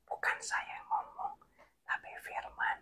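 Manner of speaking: hushed, voiceless whisper. Real, not acted. Preacher insisting to listeners that it is God's word, whispered. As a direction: whisper it as if confiding a secret. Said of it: "Kenapa saya bisik-bisik ya?".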